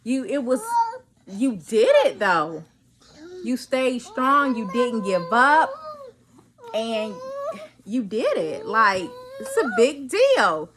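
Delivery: choked-up self-encouragement; tearful pride. Real, not acted. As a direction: talk to yourself, moved and proud. Emotional pep talk to herself.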